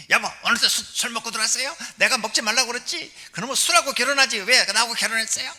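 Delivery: high, loud nagging wife scolding. Shown as a demonstration. Wife nagging her drunk husband, loud and high. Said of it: "이렇게 하면 이거 바가지 긁는 거고".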